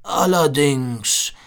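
drawn-out, musing big seal; slow, wondering seal voice. A narrator's reading. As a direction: muse slowly as the old seal. Seal speaks thoughtfully. (langsam, nachdenklich wie der Seehund)

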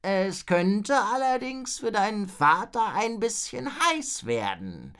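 slow, high, labouring dragon voice; drawn-out groaning, falling at the end. A narrator's reading. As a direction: stretch it out like a creaky invalid dragon. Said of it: "ächzte der".

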